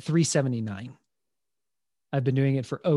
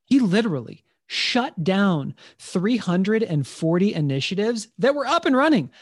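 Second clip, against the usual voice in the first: emphatic; 7 semitones higher, 4 dB louder than usual. Real, not acted. Emphasizing Steve Jobs' cuts.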